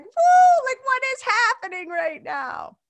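high, loud whoop of disbelief; losing their minds. Retold or imitated. Host imitates members seeing Andre step into shot, excited disbelief. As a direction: mimic a crowd going wild, high and loud. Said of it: "start losing their minds".

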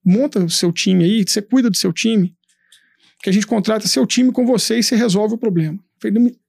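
ordinary talk

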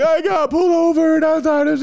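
very high, loud blubbering imitation; mock breakdown. Teen confessing to his parents he got pulled over, hammed-up breakdown. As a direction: wail it like a hammed-up sobbing teen. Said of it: "I just break down".

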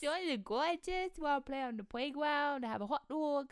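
put-on high little-kid voice, r's turned to w's; imitated small child with mangled consonants; childhood speech impediment. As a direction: talk like a tot saying "pwaygwound" for "playground". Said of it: "That's how you used to have to talk".

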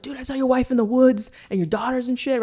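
high, fast breathless shepherd voice; excited shepherd. Retold or imitated. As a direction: blurt it out like a rattled shepherd. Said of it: "some shepherds come and they're like".

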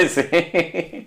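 high laugh into a yes. Real, not acted. Friend jokes about giving nose, laughing.

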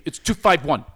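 "two five one" loud, each word punched; mimicked pedantic correction. Quoting what he says to me, "two five one" punched. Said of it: "that's what he says to me".

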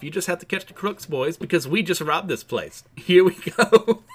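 mock-sultry thief gloating with a laugh. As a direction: tease the heroes as you speed off, laughing.

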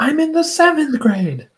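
put-on very high-pitched kid's retort; protesting daughter. Daughter correcting her dad about school, protesting. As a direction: put on an indignant little-girl voice.

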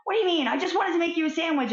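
high, fast, loud indignant attack; attacking retort. Acted. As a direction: go on the attack, offended. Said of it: "I went on the attack".

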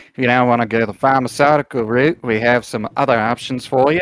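matey Aussie koala salesman; Australian koala shopkeeper. Acted. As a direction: play a helpful Australian koala behind the counter.

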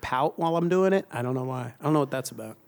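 normal talking voice

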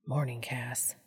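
gentle, quiet wake-up words; soft morning greeting. A narrator's reading. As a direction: murmur it into his hair. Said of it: "Dean whispered down into his wild".

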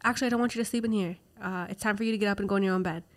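fast, matter-of-fact dismissal; brisk daughter. Retold or imitated. The daughter sending mom to bed, fast and brisk. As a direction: rattle it off quickly.

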